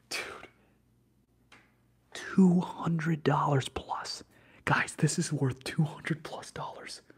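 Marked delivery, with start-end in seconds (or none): 2.1-7.0 loud, slow, emphatic excitement; stunned announcement. Real, not acted. Streamer tells chat the card's worth, loud slow amazement. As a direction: stretch out each word in amazement. Announces surprising card value.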